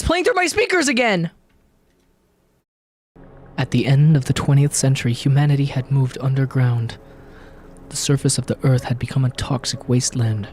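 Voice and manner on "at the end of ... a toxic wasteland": low, grave trailer narrator voice; dramatic narration. A narrator's reading. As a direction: read it like an epic movie intro. Reads game intro as narrator.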